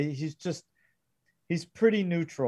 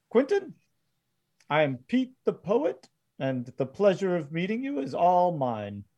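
higher theatrical greeting; courtly poet gunman; 4 semitones higher than usual. Acted. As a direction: introduce yourself with a courteous flourish. Game master voices Pete introducing himself.

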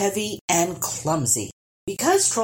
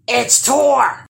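loud, higher troll holler; 7 semitones higher, 7 dB louder than usual. A narrator's reading. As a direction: yell it out, spotting the boy. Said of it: "hollered the second troll".

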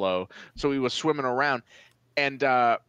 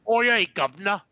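a light mock British "Oh, yeah, governor" pitched up; 7 semitones higher, 4 dB louder, faster than usual. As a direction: toss off a quick cartoon Brit. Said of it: "He's like".

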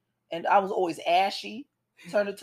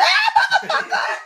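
high, loud scream breaking into laughter; retold scream; 7 dB louder than usual. Rider retelling the giant wave slide, mock scream. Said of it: "I screamed and hollered".

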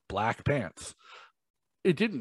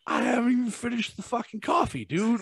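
indignant retort; 12 semitones higher than usual. Retold or imitated. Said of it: "And I'm just like".

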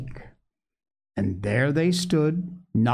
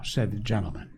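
plain reading voice

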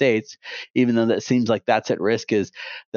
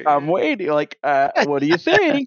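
higher-pitched, playful prodding; mock expectant; 6 semitones higher than usual. Podcaster prodding people who found out, mock-expectant tone. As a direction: fish for a verdict in a raised voice. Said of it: "a tone in my voice".

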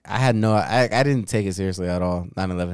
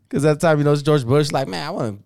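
normal talking voice

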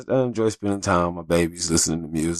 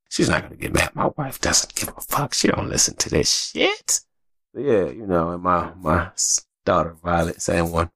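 ordinary talk, nothing marked

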